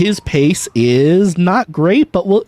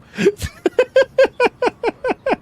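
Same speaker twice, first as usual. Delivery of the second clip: high laugh. Real, not acted. Laugh at space racism.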